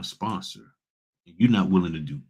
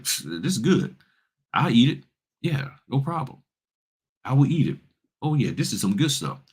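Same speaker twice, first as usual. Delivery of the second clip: higher-pitched, lightly acted eager eater; Mikey from the commercial; 6 semitones higher than usual. Mikey from the old commercial approving the food, lightly acted. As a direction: play the easygoing one who'll eat it. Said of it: "Mikey's like".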